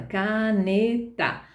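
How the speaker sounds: caneta drawn out slowly, syllable by syllable; slow sounding out. Shown as a demonstration. Teacher imitates a child slowly reading caneta. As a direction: read caneta like a child pointing at each letter. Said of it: "ela vai ler do jeitinho dela".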